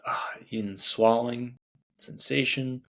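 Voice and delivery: ordinary talk, nothing marked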